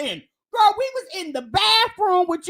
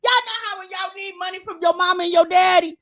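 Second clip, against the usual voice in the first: normal talking voice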